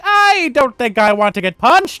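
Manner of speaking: very high, loud panicky voice; flustered guitarist. Acted. Guitarist panicking when called on, high and loud.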